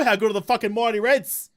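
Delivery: usual speaking voice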